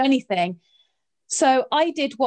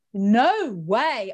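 retold disbelief. Retells refusing to believe crew.

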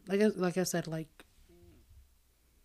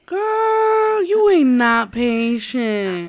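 high, loud, slow sassy teasing; louder and higher, playful sass; sassy call-out; 6 semitones higher, 10 dB louder, much slower than usual. Real, not acted. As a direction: drag it out and call her bluff. Sister teasing, calling her out.

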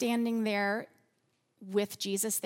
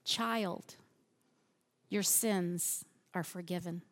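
soft, gentle, lower forgiving words; tender; 5 semitones lower, 4 dB quieter than usual. Shown as a demonstration. Preacher tenderly voicing Jesus to the paralyzed man, soft. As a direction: say it softly, like to a child. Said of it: "Do you hear the love?".

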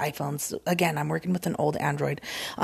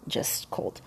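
whispered aside; 9 dB quieter than usual. Real, not acted. Aside about the coffee.

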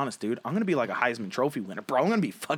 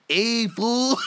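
a hammed-up accent that slides toward Chicano, pitched up; mock Russian accent; 7 semitones higher than usual. Co-host botches a Russian guy, in a laughing mock accent. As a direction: fumble a Russian voice. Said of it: "And then the Russian guy".

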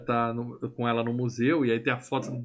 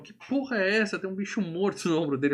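retold shocked daughter; 7 semitones higher than usual. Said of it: "A minha filha já olhou e falou".

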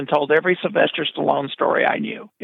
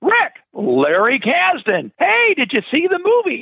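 eager Kasdan greeting; 10 semitones higher than usual. Retold or imitated. Kasdan greets him on phone.

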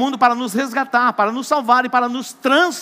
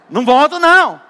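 higher, louder protest; stubborn refusal; 6 semitones higher, 9 dB louder than usual. Acted. Lazarus refusing to leave paradise, loud protest. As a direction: dig in and refuse.

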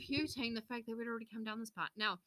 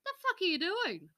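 annoyed yell; 8 semitones higher, 3 dB louder than usual. Retold or imitated. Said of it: "and I went".

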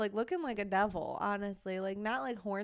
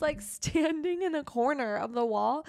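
ordinary talk

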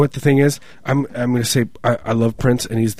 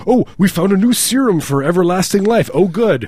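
mock excited; 6 semitones higher, 4 dB louder than usual. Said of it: "It's like".

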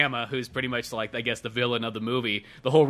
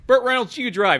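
high, loud mock order; mock bossy cop; 10 semitones higher, 5 dB louder than usual. Friend retelling a movie cop giving orders, mock bossy. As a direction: bark it like a pushy cop.

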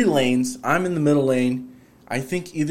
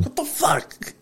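very high comic outrage; mock mad; slower than usual. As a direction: squeal it in pretend indignation. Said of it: "Just get mad".

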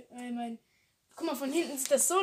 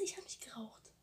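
whisper; 2 semitones lower, 12 dB quieter than usual. Real, not acted. Hushed denial of smoking.

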